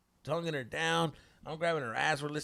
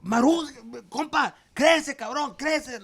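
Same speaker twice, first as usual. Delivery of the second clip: high, loud mock tirade; angry dad berating; 10 semitones higher, 11 dB louder than usual. Dad berating his son before the girls, loud imitated tirade. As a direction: imitate a fed-up father laying into his son.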